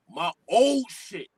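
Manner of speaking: ordinary delivery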